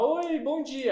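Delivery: high, singsong cheery hello; happy greeting. Shown as a demonstration. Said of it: "ela sabe que você tá feliz". (saudação feliz, bem aguda)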